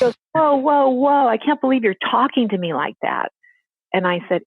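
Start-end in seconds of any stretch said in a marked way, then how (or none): 0.3-3.3 offended shock. Retold or imitated. Father offended at a reading.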